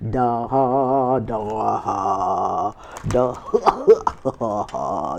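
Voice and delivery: sing-song mocking ha-da-ha; hammed-up gloating cackle; taunting laughter. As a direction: laugh right at them. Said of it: "You like".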